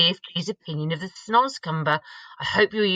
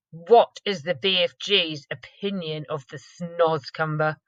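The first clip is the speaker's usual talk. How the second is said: usual speaking voice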